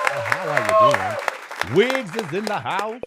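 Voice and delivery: high and loud shout-out to a viewer; loud hype greeting. Real, not acted. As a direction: belt out a welcome to the room. Host loudly greets viewer Wiggs.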